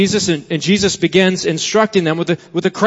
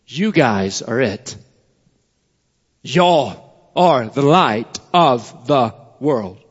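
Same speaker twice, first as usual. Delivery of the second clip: slow, lower, punching "You" and "Y'all"; emphatic you; 5 semitones lower, slower than usual. Shown as a demonstration. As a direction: lean hard on "You guys" and "Y'all" to single them out. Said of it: "it's an emphatic you".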